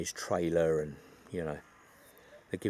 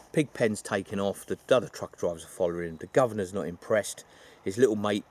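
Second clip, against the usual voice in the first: ordinary talk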